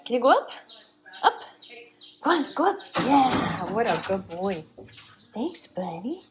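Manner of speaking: high-pitched coaxing for the dog; high puppy-talk encouragement. Real, not acted. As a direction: call the dog up in a sing-song high voice. Coaxing dog onto the table.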